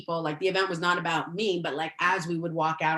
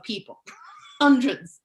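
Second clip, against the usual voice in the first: laughing; 5 semitones higher, 7 dB louder than usual. Real, not acted. Stressing how many people spoke.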